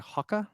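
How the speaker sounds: Hoka said as "HAH-kuh"; British pronunciation. Retold or imitated. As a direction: say Hoka with an open "hah" first vowel, British style. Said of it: "whenever British people say Hoka".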